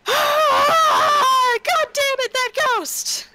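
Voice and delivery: high furious yell away from the phone; angry shout. Retold or imitated. Jessica away from the phone, yelling at the ghost, furious shout. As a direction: scream at the ghost in frustration. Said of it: "in order to say".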